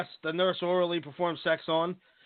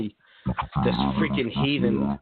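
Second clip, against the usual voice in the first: ordinary talk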